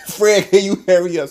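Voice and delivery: laugh-tinged hurry-up; exasperated urging. Retold or imitated. Exasperated at Fred.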